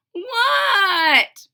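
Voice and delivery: loud high shouted answer. Acted. Froggy yells back to his father, shouting. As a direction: yell back from far off.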